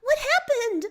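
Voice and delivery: high, loud shocked cry; horrified gasp. Acted. Nepeta sees the explosion, horrified high cry. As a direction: gasp it out in horror, pitched high. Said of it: "Nepeta gasps in horror".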